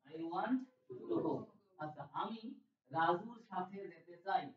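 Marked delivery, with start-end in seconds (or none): 0.1-1.3 slow model. Shown as a demonstration.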